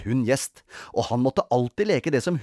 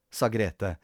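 ordinary delivery

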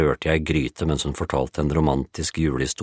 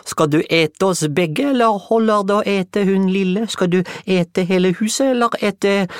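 hammed-up fright; 5 dB louder than usual. Juliette begging the woodpile not to eat them, hammed-up fright. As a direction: beg the monster in mock terror.

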